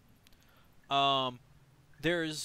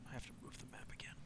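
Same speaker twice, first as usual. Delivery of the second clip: fast whispered aside to self; whispering; 18 dB quieter, much faster than usual. Real, not acted. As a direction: mutter it under your breath, quickly. Muttering aside while moving map.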